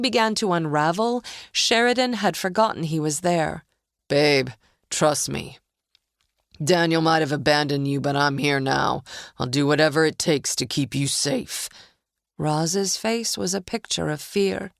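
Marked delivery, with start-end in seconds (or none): 4.1-11.7 sinister coaxing man. A narrator's reading. Mike coaxing Roz.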